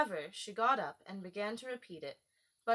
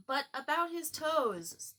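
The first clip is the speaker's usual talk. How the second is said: persistent; 7 semitones higher, 4 dB louder, slower than usual. A narrator's reading. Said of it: "the Mock Turtle persisted".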